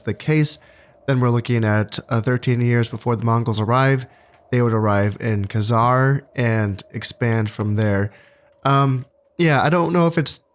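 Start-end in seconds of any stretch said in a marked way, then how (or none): none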